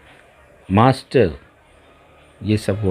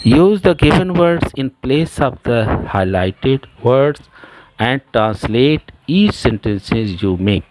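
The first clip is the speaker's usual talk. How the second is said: very slow, deliberate dictation pace for the class; slow textbook reading; 3 semitones higher, much slower than usual. Shown as a demonstration. Teacher reading the exercise to her class, slow and deliberate. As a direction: spell out each word so pupils can follow.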